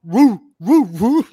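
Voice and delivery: loud, high hooting cheer; mock cheer. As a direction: hoot like a pep rally.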